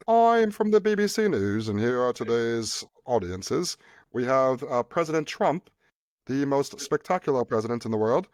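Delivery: mock British news anchor; British accent. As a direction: announce it like a BBC presenter with a put-on British accent. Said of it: "in a British accent".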